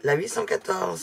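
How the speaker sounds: ordinary talk, nothing marked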